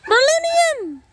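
high, long-carrying dog call. Real, not acted. Owner calling the missing dog, high holler.

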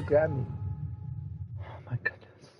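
breathless hushed whisper; whispered while hiding. Real, not acted. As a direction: keep it under your breath so nobody hears.

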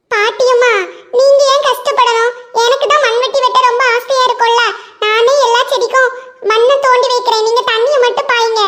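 put-on high child's voice, coaxing; a boy sweet-talking grandma; eager little boy. Ringu coaxes grandma to keep his shovel, high child voice.

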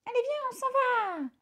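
very high, bright call; excited little bird; eager urging. A narrator's reading. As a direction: hurry him along, thrilled. César urges father to leave.

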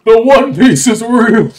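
loud, high triumphant yell; hype shout. Real, not acted. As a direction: shout it like a grand reveal.

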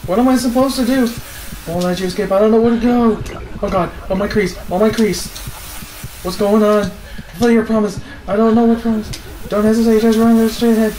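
high, loud frantic yelling; panicked yelling. Real, not acted. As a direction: scream it while running for your life. Fleeing a monster in panic.

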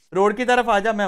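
loud call to a teammate. Real, not acted. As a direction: shout it across the map. Calling teammate toward road.

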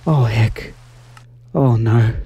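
dismayed, dropping into a breath; a little emotional. Real, not acted. Player losing zombie villagers to a creeper, a little emotional. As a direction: let the no's fade, then apologise through a small laugh. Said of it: "I'm getting a little bit emotional".

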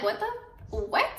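high-pitched, hushed double take; squeaky what the, what; stunned disbelief. Retold or imitated. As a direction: pitch shoots way up in shock. Shocked reaction to friend's tag.

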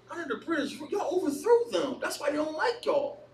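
high-pitched mock disbelief; incredulous retort. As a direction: echo it in disbelief, then rise on the comeback. Retells his incredulous inner retort.

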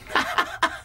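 short, high burst of laughter; scoffing laugh. Acted. Inmate reacting to a sentence without parole, derisive laugh. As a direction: laugh out at the absurdity.

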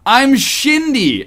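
put-on slow, slushy drunken introduction; slurred drunk. Drunk Cindy greets her new neighbor, slurring. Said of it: "she slurs to her new neighbor".

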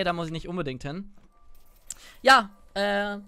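usual speaking voice

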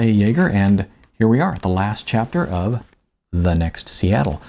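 ordinary talk

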